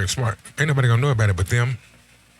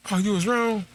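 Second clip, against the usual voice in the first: put-on much higher, teasing girlfriend voice; mock girlfriend; 12 semitones higher than usual. Girlfriend catching her man admitting fault, high mock tease. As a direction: toss it back like the girlfriend catching him out.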